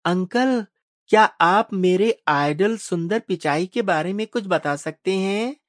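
put-on high-pitched little boy's voice; put-on child voice, pitched well up; young boy. A narrator's reading. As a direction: ask like an eager child. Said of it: "पीछे बैठे आदित्य ने कहा".

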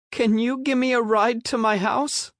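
put-on high timid kid asking; small boy voice. A narrator's reading. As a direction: ask meekly like a little boy. Small boy asks a ride.

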